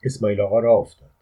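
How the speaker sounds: ordinary delivery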